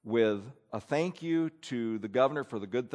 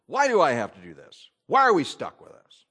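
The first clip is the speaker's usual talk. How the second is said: grumbling complaint; 8 dB louder than usual. Acted. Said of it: "murmurings is ramped up to the next level".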